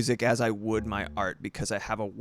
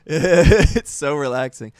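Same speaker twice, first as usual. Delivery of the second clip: laughing; 3 semitones higher, 10 dB louder, slower than usual. Real, not acted. Laughing after humming.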